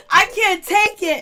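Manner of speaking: laughing till it almost cries; can barely get words out for laughing; laughing hard. Real, not acted. Vlogger cracking up over the cast's jokes, laughing helplessly.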